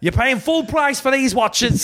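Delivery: very high, laughing trader's rant; hammed-up indignant haggler; mock outrage. Watch seller scolds newborn, high mock outrage.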